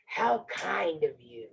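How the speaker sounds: slow, low wolf. Acted. Wolf thanks the girl, slow low wolf voice. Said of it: "the wolf said".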